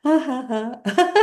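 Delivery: forced laughter. Shown as a demonstration. Teacher leads class in laughter exercise, high laughing. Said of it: "just keep doing that".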